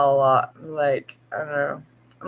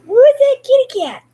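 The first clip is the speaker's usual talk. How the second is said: pet talk; 6 dB louder, faster than usual. Real, not acted. Owner asking cat about her reflection, excited high baby talk. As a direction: tease the cat playfully. Cat sees herself in mirror.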